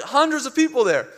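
ordinary delivery

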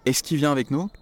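"Est-ce qu'il" said as "ess-ki", the L dropped; casual spoken French. Shown as a demonstration. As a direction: say it like a native, quick and run together.

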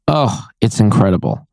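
usual speaking voice

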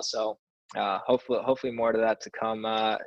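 normal talking voice

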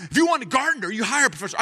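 high-pitched mock protest; arguing husband. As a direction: replay your side of a marital fight, indignant. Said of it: "turned into a fight".

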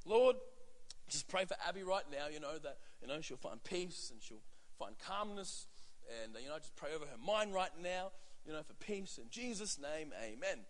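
put-on soft prayer while holding the upset child; soothing prayer. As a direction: pray gently to calm her. Soothing prayer over crying daughter.

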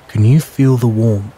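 ordinary delivery, nothing marked

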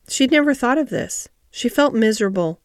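ordinary delivery

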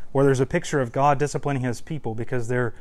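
usual speaking voice

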